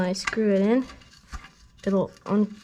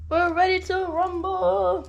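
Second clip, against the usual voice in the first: pitched up, slow hype call; announcer hype; 9 semitones higher, 6 dB louder, slower than usual. Acted. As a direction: announce it like a ring host.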